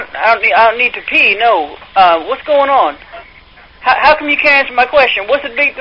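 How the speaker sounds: ordinary delivery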